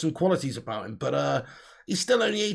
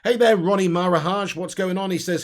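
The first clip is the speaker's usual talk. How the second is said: ordinary talk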